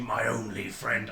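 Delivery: slow, low, soft imitation of a solemn line; imitated zombie farewell. Player quoting the zombie's opening words, slow and solemn. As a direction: draw out "My only friend" as a mock-solemn quote. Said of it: "when you started off by saying".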